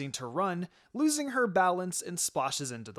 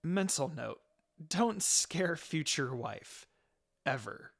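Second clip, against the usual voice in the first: slow inner thought; slower than usual. Acted.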